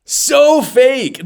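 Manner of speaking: high, loud scoff. Real, not acted. Host mocking politician's accent, high loud scoff. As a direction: sneer at the fakery, pitched high. Reacting to Crockett clip.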